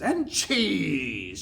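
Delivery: high, drawn-out villain exclamation; witch's shout. A narrator's reading. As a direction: call it out with wicked relish. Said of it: "shouted the Grand High Witch".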